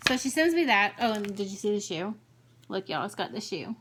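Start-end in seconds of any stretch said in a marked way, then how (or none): none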